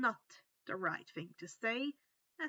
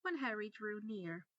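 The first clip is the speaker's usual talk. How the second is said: ordinary delivery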